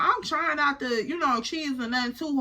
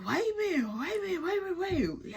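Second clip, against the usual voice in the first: high, soft flustered protest; caught off guard; 6 semitones higher, 6 dB quieter, much faster than usual. Retold or imitated. Storyteller reacting to his flirting, caught off guard, high and soft. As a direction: stall in a small, high retold voice. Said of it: "I'm caught off guard".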